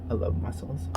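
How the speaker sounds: soft unvoiced whisper. Real, not acted. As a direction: whisper it like a secret. Eating mussels on camera.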